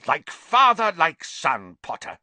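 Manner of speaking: loud, high start, falling away; mad fury. A narrator's reading. Snape likening Potter to his father, furious. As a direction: burst out bitterly, then drop. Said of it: "looking madder than ever".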